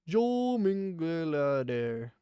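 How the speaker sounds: reading a viewer's name aloud slowly; slow careful reading. Shown as a demonstration. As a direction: sound out the name syllable by syllable. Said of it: "that accent mark is in".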